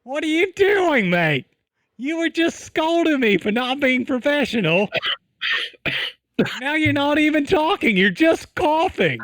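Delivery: high-pitched, incredulous telling-off; exasperated scolding. Acted. Co-host berates his coughing partner, exasperated.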